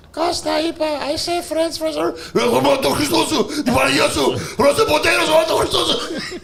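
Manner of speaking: put-on high, wailing accented wife; hammed-up Greek waitress. Waitress to her husband in the kitchen, high wailing voice. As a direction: stretch it out in a high mock-Greek complaint.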